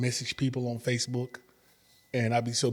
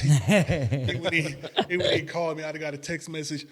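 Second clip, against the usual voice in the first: high, laughing through the words; laughing retelling; 4 semitones higher, 3 dB louder than usual. Retold or imitated. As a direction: giggle out the story.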